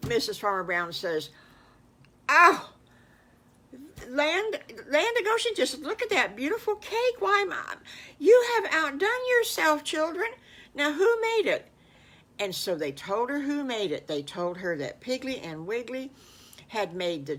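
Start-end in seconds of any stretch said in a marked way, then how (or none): 2.3-11.7 much higher, louder gushing; amazed delight. A narrator's reading. Mrs Farmer Brown marveling at her cake, high and loud. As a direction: gush over the beautiful cake.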